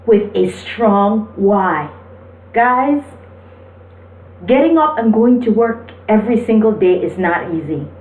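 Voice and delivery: ordinary talk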